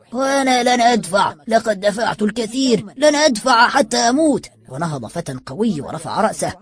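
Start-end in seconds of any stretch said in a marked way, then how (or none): 0.1-4.5 put-on raised old man's voice, higher; mild lightly voiced old man's shout. A narrator's reading. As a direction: call out stubbornly from afar. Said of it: "وصاح عجوز يجلس بعيدا".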